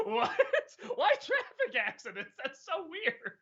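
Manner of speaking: high-pitched amused disbelief; mock bafflement. Acts out baffled reaction, amused.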